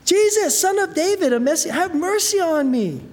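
high, loud desperate cry for mercy; a blind beggar calling out over the crowd; frantic cry. Retold or imitated. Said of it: "I'm sure frantically".

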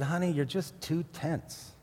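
soft, slow, dry wife; mild punchline wife voice. Retold or imitated. Wife to dreaming husband, softly teasing. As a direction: deliver it slow and quiet as the punchline. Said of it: "She said".

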